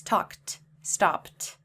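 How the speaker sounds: one-syllable talked and stopped; correct t ending. Shown as a demonstration. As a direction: model the right way. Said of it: "but that is incorrect".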